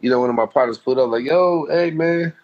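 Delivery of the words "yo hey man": higher, upbeat imitation of a buddy; lightly voiced friend saying hey; friend's greeting. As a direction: call out to your partner from the car.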